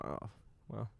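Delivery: mild muttered letdown at the dealership; quiet resigned sigh. Retold or imitated. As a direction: let the air out, resigned. Disappointed at the dealership.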